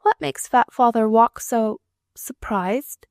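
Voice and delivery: put-on high, slow child's voice, wondering; a small girl puzzling aloud; curious little girl. A narrator's reading. Little girl asking her nurse about father.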